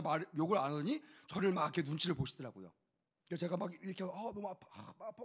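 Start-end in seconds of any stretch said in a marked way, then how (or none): 4.0-5.2 hammed-up pained groan, trailing to a near whisper; mock whimper of a man hit on the head; moaning in pain. Pastor acts hurt before the angry father, mock pained moan. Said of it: "막 이러고 있다가".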